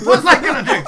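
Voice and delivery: very loud, high-pitched shouted retort; in-character yell. Acted. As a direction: yell back over the noise of a fight. Player yells back in character.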